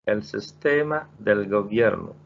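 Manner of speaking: El sistema del gobierno said very slowly, word by word; slow model reading. Shown as a demonstration. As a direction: read it out slowly for learners. (قراءة بطيئة للمتعلمين)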